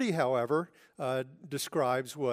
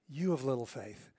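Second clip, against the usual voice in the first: gentle, softer rebuke with a hint of sadness; kind, compassionate; 2 semitones lower, 5 dB quieter than usual. Jesus gently rebuking the sinking Peter, soft and compassionate. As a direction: say it kindly, not in anger. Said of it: "we should hear kindness".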